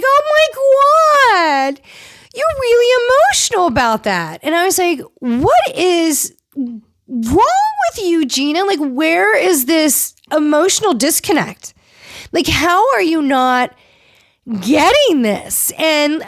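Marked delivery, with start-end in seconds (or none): 0.0-4.4 very high, stretched-out mimicry; mocking disbelief. Mocking Gina's dismissive reaction to tears. 5.2-15.7 high, slow, drawn-out scolding; exasperated disbelief. Retold or imitated. Retells own exasperation with Gina.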